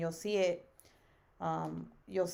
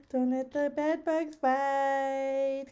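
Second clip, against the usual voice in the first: higher, slow, mock-awkward bed bugs line; awkward good night; 7 semitones higher, 5 dB louder, much slower than usual. Streamer mocks her own awkward goodnight, slow and high. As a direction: drawl it out cringing at yourself. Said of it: "most awkward good night".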